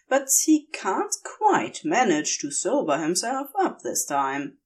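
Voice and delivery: ordinary delivery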